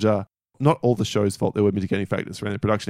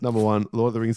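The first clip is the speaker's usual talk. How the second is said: ordinary talk, nothing marked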